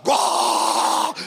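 brushing off the wife's plea; dismissive shout. Retold or imitated. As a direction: wave her off with a bark.

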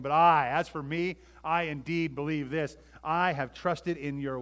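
"I" hit loud and long; emphatic declaration. Acted. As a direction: declare it, punching every "I". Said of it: "It is emphatic saying".

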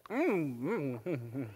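humming through a mouthful of mouthwash; swishing mouthwash. Real, not acted. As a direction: mouth full of liquid. Swishing mouthwash before congregation.

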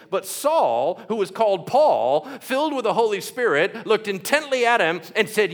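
scripture reading. Acted. Preacher reading Paul's curse aloud, rising and forceful. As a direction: build into Paul's rebuke with force. Reads the Elymas passage aloud.

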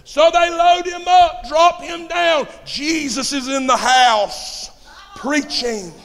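high, slow preacher's shout; loud, stretched-out pulpit cry; full-force preaching. Acted. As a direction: proclaim it to the whole congregation, drawn out.

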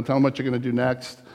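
ordinary talk, nothing marked

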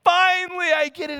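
high, loud, joyful relief; overjoyed dad. Retold or imitated. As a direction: sweep him up and exult. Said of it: "I'm like".

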